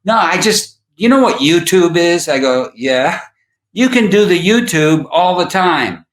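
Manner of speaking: high, eager put-on voice; an excited friend explaining YouTube; lightly hammed-up old friend; imitating Lance. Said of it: "And he's like".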